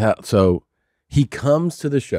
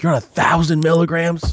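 disbelieving question, pitched up high; stunned echo; 7 semitones higher than usual. Retold or imitated. Telling Joey's dose back to him in disbelief, pitched up high. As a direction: repeat it back in shock, voice jumping higher.

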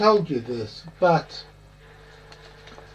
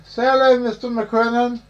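calling out; 7 semitones higher, 7 dB louder than usual. Real, not acted. Cueing a co-host to greet.

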